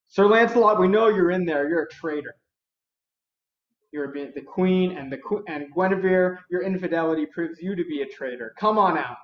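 storyteller playing knights demanding surrender; acted accusing call.

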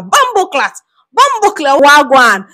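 high, punchy mock Jamaican accent; imitated Jamaican accent. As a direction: put on a playful Jamaican accent. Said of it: "His accent makes everything. It's Jamaica".

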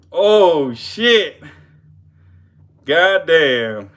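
loud, high cry of amazement; real excited outburst; excited exclamation. Real, not acted. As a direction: shout it in disbelief. Reacting to the strike.

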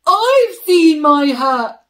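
loud burst of recognition; sudden realisation. Acted. Bear suddenly remembering where his hat is, exclaiming loudly. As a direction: shout it as it dawns on him.

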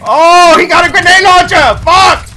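high, loud yell of real alarm; alarmed shout. Real, not acted. Player warns teammates of a grenade launcher, alarmed shout. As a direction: shout a panicked warning mid-fight.

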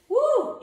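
high loud cheer; excited whoop. Real, not acted. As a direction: whoop it up with energy. Coach cheers after set.

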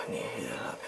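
whispered under his breath; whispered aside. Real, not acted. Player whispering to himself about healing up, hushed. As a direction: murmur it to yourself in a hush.

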